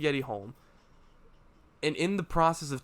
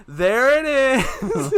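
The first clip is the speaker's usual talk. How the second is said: slow, drawn-out, higher and louder; Dave's punchline; 10 semitones higher, 9 dB louder, much slower than usual. Retold or imitated. Retelling Dave's line to a friend, drawn out. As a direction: stretch it out like the song hook. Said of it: "And then Dave goes".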